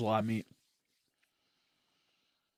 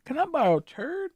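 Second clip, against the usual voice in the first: high, mocking friend voice; high and louder mocking imitation; imitating friend; 12 semitones higher, 6 dB louder than usual. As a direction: mimic the friend, rising high. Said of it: "When he asked me for my channel".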